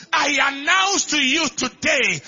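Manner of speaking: high, shouted prophetic declaration; full-force preaching. Acted. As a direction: proclaim it at the top of your voice to rouse a congregation. Preaching at full force, calls for Amen.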